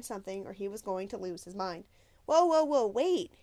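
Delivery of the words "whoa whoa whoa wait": loud, high call to stop; crying out. A narrator's reading. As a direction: shout after him to wait. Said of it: "Chuck cried".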